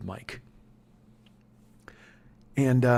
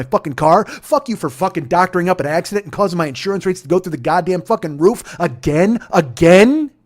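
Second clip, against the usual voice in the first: loud, raised, relentless ranting, building at the end; furious tirade; 4 semitones higher, 7 dB louder than usual. Retold or imitated. Driver curses entitled passengers for everything, furious tirade. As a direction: vent with hard punches on the swearing.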